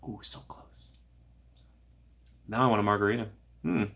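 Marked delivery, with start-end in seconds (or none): 0.1-0.9 breathy whisper of "Ooh, so close"; conspiratorial whisper. Acted. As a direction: drop to a secret whisper. Reacting to a chat attempt.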